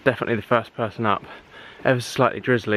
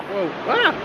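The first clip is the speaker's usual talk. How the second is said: startled; 10 semitones higher than usual. Real, not acted. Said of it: "I've just, uh, sunk in the sand".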